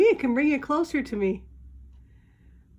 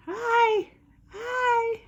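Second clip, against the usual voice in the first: high, bright sing-song greeting; 11 semitones higher, 5 dB louder than usual. Real, not acted. Owner greeting her pet bird in a high sing-song.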